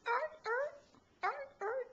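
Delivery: high barking arr arr; playful high seal calls. Pretend. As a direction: bark like a seal for the baby. Seal barks for baby.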